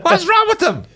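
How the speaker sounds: high, loud, incredulous outburst; mock exasperation. Friend protests about the Italians, in mock exasperation. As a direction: yell it in comic disbelief.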